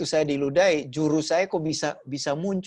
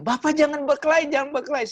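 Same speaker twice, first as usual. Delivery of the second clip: much higher, louder anxious plea; pleading not to fight; 9 semitones higher, 3 dB louder, faster than usual. Retold or imitated. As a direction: urgently hold the boss back. Staff begs him not to fight.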